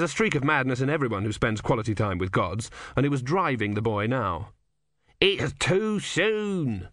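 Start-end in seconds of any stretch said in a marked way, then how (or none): 5.2-6.9 very high, very slow stretched-out cry; high tortoise god yelling. Acted. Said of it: "Om yelled".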